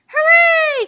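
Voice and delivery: high, loud cheer; cheering kids. Acted. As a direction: yell hooray like excited kids. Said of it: "cried the children".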